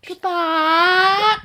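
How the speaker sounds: wailed farewell; loud goodbye. Acted. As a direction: cry it out loud. Dramatic exit to radiator.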